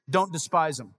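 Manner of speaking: ordinary delivery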